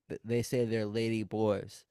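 ordinary talk, nothing marked

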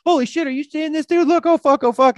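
high, loud, freaked-out shouting; excited outburst. Retold or imitated. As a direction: blurt it out like you're seeing a UFO. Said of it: "I vocalize things".

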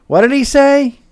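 put-on high, loud old lady asking; a deaf old woman calling out, high-pitched; elderly wife shouting her question; hard-of-hearing wife. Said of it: "his wife, who was a little hard of hearing".

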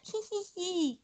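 high breathy villain giggle; scheming giggle. Real, not acted. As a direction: cackle softly like you've won. Taunting rival, then giggles.

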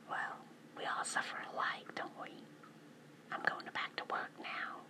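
whispering. Real, not acted. Whispers through most of her talk.

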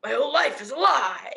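ordinary delivery

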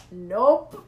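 loud, rising "nope"; retold firm refusal. Turning down a twenty-one-year-old, loud mock refusal. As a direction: shut him down loudly. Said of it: "And I'm like".

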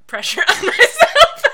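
real laughter bursts through the last words; reading aloud until the giggles win; breaking into laughter. Real, not acted. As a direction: lose it and laugh through the line. Reader cracks up mid-reading.

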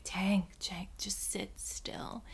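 unvoiced whisper, slow on sit still; whisper to the dog. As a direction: hush the dog without moving. Pleading with dog quietly.

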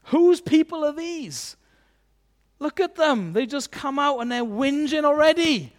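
high, loud mock outrage; indignant angels. Angels coming down to disown the people, high indignant complaint. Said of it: "imagine they said this".

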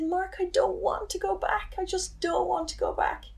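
high-pitched, retold upset protest; tearful plea. Telling her brother she can't face going back, tearful plea. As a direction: plead as if close to crying, voice pitched high. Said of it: "I just kept crying and crying".

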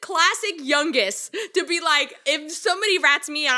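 high, loud laughing exclamation; mock outrage. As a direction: exclaim in amused indignation. Retold mock outrage at youngest.

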